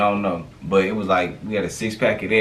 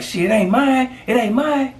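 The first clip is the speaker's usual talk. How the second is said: much higher panicked denial; high mock denial; 13 semitones higher than usual. Friend denying the bag to cops, high mock denial. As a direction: squeak out it's not mine.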